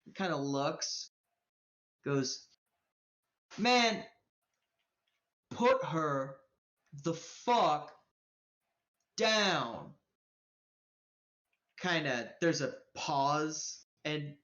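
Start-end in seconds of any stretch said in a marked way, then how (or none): 3.6-9.9 each word set apart by long pauses; menacing command. Acted. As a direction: order it slowly, word by word. Tough stranger orders a release.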